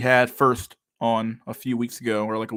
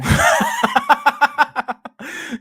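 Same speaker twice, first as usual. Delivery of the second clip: big burst of high cackling laughter; loud high laugh; 6 dB louder than usual. Real, not acted. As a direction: howl with laughter. Laughs at his own joke.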